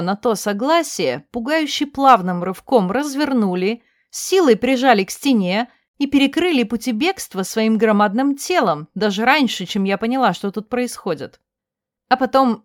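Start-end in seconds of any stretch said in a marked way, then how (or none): none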